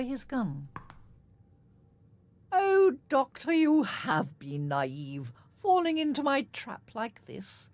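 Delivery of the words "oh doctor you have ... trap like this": slow, loud, relishing each word; gloating villain. A narrator's reading. Rook gloats at the captured doctor, slow and smug. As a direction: savor the trap like a villain. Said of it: "Rook said".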